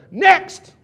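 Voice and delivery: high, loud retold call; yell. Rep calling in the next appointment, yelling. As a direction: re-enact a big summons through the door. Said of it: "and I yell".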